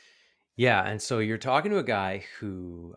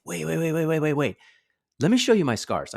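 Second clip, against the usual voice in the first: higher and louder rapid wait, wait; urgent reassurance; 8 semitones higher, 6 dB louder than usual. Retold or imitated. Man rushes to reassure crying Ben, higher urgent voice. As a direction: hurry to stop the kid giving up. Urgently stopping upset kid.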